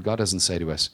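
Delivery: ordinary talk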